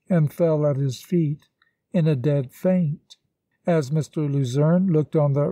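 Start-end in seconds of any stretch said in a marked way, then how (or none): none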